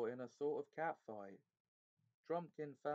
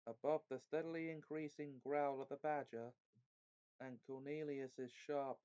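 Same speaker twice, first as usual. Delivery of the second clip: ordinary delivery, nothing marked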